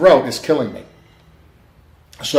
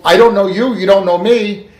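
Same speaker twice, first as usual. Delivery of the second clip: higher, emphatic pleading; louder, urgent insistence; retold insistence; 8 semitones higher, 5 dB louder than usual. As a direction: press the point hard, retelling it. Urging her to believe the message.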